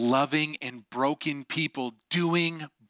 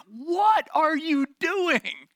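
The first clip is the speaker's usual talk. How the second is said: high, drawn-out imitation of Dad yelling; mock dad's yell; 12 semitones higher, 3 dB louder, much slower than usual. Dad yelling at the sisters after the fall, mock outraged yell. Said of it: "comes over and yells at them".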